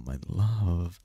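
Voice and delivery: ordinary talk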